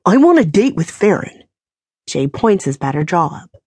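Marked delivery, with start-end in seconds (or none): 0.0-1.4 louder, defiant demand. A narrator's reading. Beaten Jay demanding a date, all defiance. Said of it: "all defiance".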